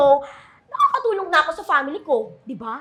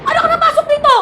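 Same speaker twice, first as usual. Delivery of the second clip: startled fright; 10 semitones higher, 6 dB louder, faster than usual. Acted. Startled by intruder ghost.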